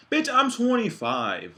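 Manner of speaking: loud, slow, incredulous retort; exasperated outburst. Real, not acted. Fed up with people doubting her, a loud retort. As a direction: fire it back, fed up and loud.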